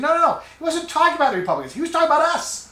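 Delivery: high, fast protest; insistent rebuttal. Retold or imitated. Host retelling his rebuttal to the advisor, insistent. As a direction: cut in with a high-pitched objection.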